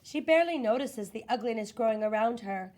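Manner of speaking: ordinary delivery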